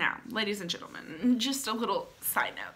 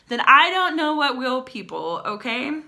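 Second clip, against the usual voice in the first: punchy, louder mock exasperation; exasperated; 4 semitones higher, 9 dB louder than usual. Real, not acted. Vlogger mocking a lovesick ad, exasperated outburst. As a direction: throw it at the audience like a punchline.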